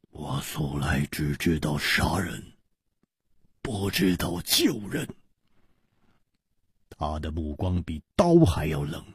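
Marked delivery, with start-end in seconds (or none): 0.1-5.1 low, soft, icy statement; cold killer. A narrator's reading. Killer refuses to save anyone, coldly. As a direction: answer coldly and quietly, pitched well down. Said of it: "易点红冷冷地说".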